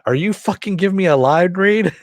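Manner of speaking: high, loud mock rant; quoting Clayface's outburst. Podcaster quoting Clayface's angry line read. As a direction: snap the line back like an offended actor. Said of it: "Clayface giving Riddler the line read".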